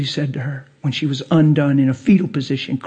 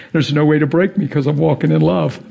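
normal talking voice

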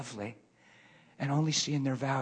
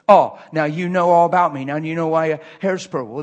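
loud, indignant retort, louder; angry retort; 2 semitones higher, 12 dB louder than usual. Retold or imitated. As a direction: throw it back at him, offended. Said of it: "he got mad".

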